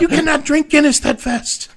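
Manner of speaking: very high, loud Irish brogue; tipsy Irish protest; Irish drunk. Retold or imitated. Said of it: "It was".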